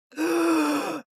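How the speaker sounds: sharp gasp for air, high; big gasp. Retold or imitated. Wife reenacting her first breath after choking, sharp gasp. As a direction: gulp in a huge breath as if unchoked. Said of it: "I took a huge breath".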